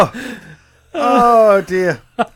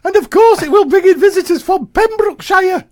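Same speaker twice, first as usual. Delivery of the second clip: high, loud squeaky voice; helium voice; 8 semitones higher, 3 dB louder than usual. Retold or imitated. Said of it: "something off Monty Python".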